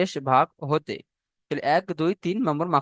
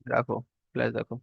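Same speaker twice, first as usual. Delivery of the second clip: quiet aside; 2 semitones lower, 9 dB quieter than usual. Real, not acted. Aside to a student mid-lesson.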